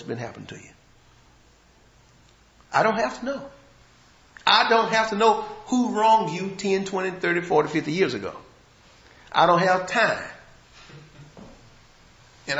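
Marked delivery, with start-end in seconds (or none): 2.7-10.2 louder, higher preacher's cry; rising to a pulpit pitch; fervent sermon; full-force preaching. Acted.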